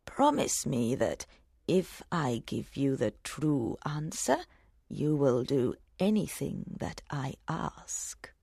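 put-on hushed old woman binding a promise; old hag whispering. A narrator's reading. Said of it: "said the hag".

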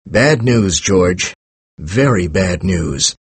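grave, falling lines, slowing at the end; sad. A narrator's reading. Professor breaking bad news to his friend George, sadly. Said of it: "said Professor Massey sadly".

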